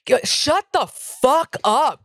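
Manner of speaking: high, slow, drawn-out telling-off; exasperated comeback. Retold or imitated. Comic silencing a heckler from the stage, high and slow exasperation. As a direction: stretch out each word at the heckler.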